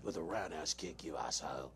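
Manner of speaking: low, soft mock British threat; Statham voice. Closing the show in the Statham voice. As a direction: sign off in a low Cockney growl.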